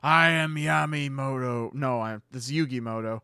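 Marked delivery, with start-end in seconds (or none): 0.0-1.7 loud, slow, grizzled put-on voice; Yami. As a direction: intone it like a grizzled anime hero. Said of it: "doing his Grizzled voice".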